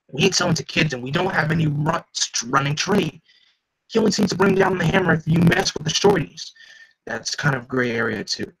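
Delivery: hammed-up gangster banter; thug voices. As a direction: play jumpy mobsters bickering. Voicing thugs in story.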